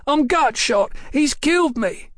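high-pitched wail of alarm; squawking. A narrator's reading. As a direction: shot ruffian's panicked squawk. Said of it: "the ruffian squawked".

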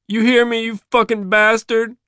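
high, loud burst of fury; tearful rage. A narrator's reading. Son at gunpoint to his father, boiling rage. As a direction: lash out high while close to crying. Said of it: "trying to keep his rage on the boil".

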